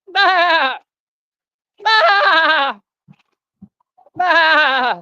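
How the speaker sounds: high, loud wavering bleat; loud imitated goat cry. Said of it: "I can beg 'cause I'm a goat".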